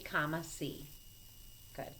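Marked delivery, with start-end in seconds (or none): none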